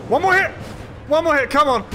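high, loud, desperate urging; real boss-fight panic; frantic shout. Real, not acted.

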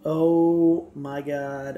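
slow words stretched through a yawn. Real, not acted. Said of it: "I hate yawning".

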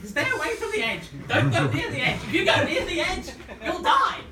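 high, loud mock motherly warning; hammed-up nagging mum shrieking a warning; alarmed mother. Mother warning child off the edge, hammed-up high yell. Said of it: "the little voice is your mother going".

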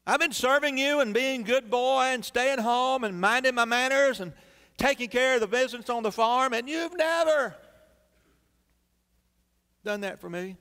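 high, petulant singsong complaint; jealous whining son. Acted. Elder son whining to his father about favouritism, petulant and high. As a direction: whine it like a jealous child.

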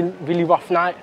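ordinary delivery

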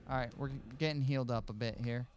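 ordinary talk

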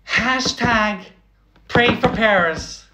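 high, hammed-up voice of a shallow poster; mock Twitter girl.

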